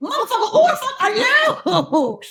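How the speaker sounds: high, incredulous outburst breaking into laughter; mock disbelief. Actress reacting to her gorgeous co-star, mock disbelief. Acted shock at Adonis co-star.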